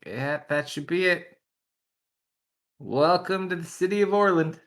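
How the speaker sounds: lightly voiced cheerful guide, rising; friendly guide voice. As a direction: announce the city proudly. GM voices NPC welcoming party.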